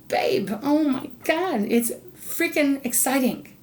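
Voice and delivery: slow, high gush of excitement; excited. Real, not acted. Reader tells Sagittarius their energy is exciting, excited. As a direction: draw it out in thrilled disbelief. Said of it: "I'm excited for you".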